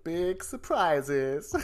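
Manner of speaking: slow, mock-quoting big surprises; drawn-out sarcastic quote; air quotes. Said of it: "in inverted commas".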